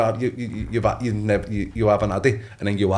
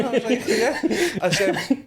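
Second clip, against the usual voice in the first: laughing; 11 semitones higher than usual. Real, not acted. Laughing while retelling his reaction.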